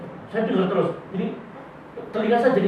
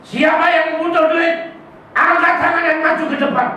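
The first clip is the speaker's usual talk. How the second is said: mock prosperity preacher; 8 semitones higher than usual. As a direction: lure the crowd forward like a greedy preacher. Mocking a money-prayer preacher.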